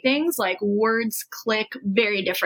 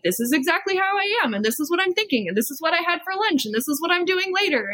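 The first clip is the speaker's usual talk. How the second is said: rattling off updates; 6 semitones higher, faster than usual. Retold or imitated. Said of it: "block of messages of just".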